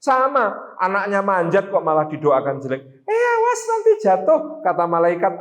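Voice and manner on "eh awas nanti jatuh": high, sharp mock cry of warning; much higher alarmed call. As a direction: call up in alarm to a climbing child. Warning shout to climbing child.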